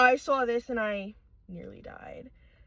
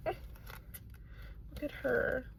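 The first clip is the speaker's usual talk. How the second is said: whispering; 11 dB quieter, much slower than usual. Real, not acted. Whispering to a new plush.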